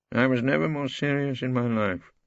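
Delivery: ordinary delivery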